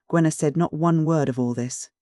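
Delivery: usual speaking voice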